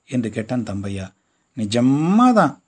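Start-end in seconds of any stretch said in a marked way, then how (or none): 1.6-2.6 drawn-out, loud teasing insistence; lightly lying to tease the kids; mother playfully pretending, slow and loud; fibbing. A narrator's reading. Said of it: "பொய் சொல்லிவிட்டு".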